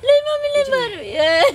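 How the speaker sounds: high, loud crying shout begging her not to hit; crying plea. Acted. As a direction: wail "mummy" through sobs as the blows land. Daughter-in-law being beaten, begging. (रोते हुए ऊँची चीख में गिड़गिड़ाना)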